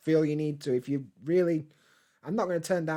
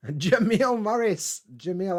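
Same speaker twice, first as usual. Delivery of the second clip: excited greeting; 5 semitones higher, 5 dB louder, slower than usual. Real, not acted. Greeting a viewer on stream.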